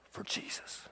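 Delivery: breathy hush on the last words; whispered. Real, not acted. Preacher to congregation, a hushed whisper. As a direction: drop to a whisper.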